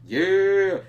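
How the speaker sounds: loud, high victory yell; triumphant shout. Retold or imitated. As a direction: bellow it like he just won. Nandor cheering after punch.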